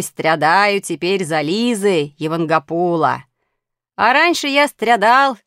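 swaggering 'стрядаю' and 'стрядал', 'я' replacing 'а'; affected street pronunciation. A narrator's reading. Street boy boasting of his loves, affectedly saying 'стрядаю', 'стрядал' with 'я' for 'а'. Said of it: "произносить «я» вместо «а»".

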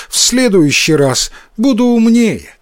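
put-on high child's voice; promising little boy. A narrator's reading. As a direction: promise like a chastened kid. Boy promises his mother.

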